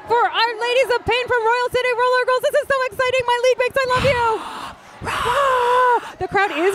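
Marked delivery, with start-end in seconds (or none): 0.6-4.4 much higher, gushing with excitement; overjoyed cheering for her team; high excited gush; thrilled. Real, not acted. League wins, announcer thrilled. 5.0-6.1 excited gasp. Real, not acted. League wins, announcer thrilled.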